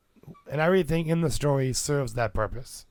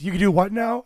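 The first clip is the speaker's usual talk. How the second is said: incredulous; 8 semitones higher, 4 dB louder than usual. Retold or imitated. Player surprises the DM.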